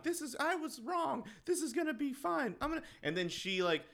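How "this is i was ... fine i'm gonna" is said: high mock monologue. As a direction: squeak out his inner thoughts. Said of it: "internal monologue".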